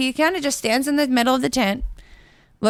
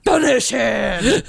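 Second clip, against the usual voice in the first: slow, drawn-out announcer call; mock arcade announcer; Mortal Kombat announcer; 2 semitones higher, 3 dB louder, much slower than usual. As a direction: boom it like the fighting game voice. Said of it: "like a Mortal Kombat".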